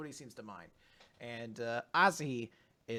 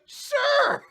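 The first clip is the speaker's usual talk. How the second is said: very high, loud squeal; snatch the offer with glee; eager yes; 8 dB louder than usual. Retold or imitated. Fumino accepting the plushie at once, high eager squeal.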